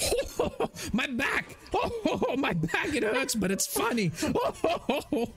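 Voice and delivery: very high, hammed-up belly laughter; old man howling with laughter through his pain; laughing scream. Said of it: "he starts screaming out".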